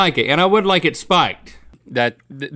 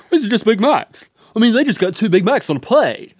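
lightly voiced skeptical customer; a hint of a know-it-all diner; 4 semitones higher than usual. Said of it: "That's how they all talk".

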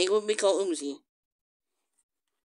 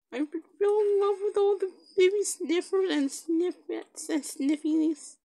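high cutesy gushing, slowing at the end; 7 semitones higher than usual. Real, not acted. In love with the baby sniffers, gushing. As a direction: gush in a high, adoring voice.

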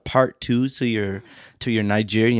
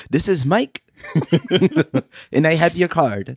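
higher, sing-song phone rep; customer service voice; 6 semitones higher than usual. Retold or imitated. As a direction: play a scammy call center agent.

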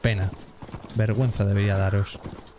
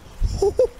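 sudden high-pitched laugh. Real, not acted. Gets the glider, laughs.

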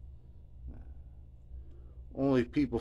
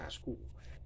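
whisper; 3 semitones lower, 18 dB quieter, slower than usual. Retold or imitated. Humoring Darren's odd claim.

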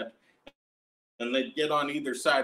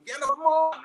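put-on high, louder child's yell; kids yelling; 11 semitones higher, 4 dB louder than usual. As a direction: shout it like an impatient nine-year-old in line.

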